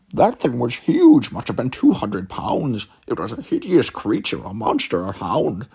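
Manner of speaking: spooked, making the beast sound huge; scared. Acted. Sherlock tells Watson of the monster, mildly scared.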